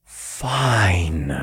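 long, breath-led surrender; sighed. A narrator's reading. Hero calms his frustration and gives in, sighing. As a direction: let it out on a sigh. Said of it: "I sighed".

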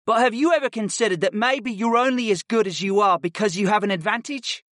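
cocky pupil questioning his teacher; drawling boy. A narrator's reading. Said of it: "the boy drawled".

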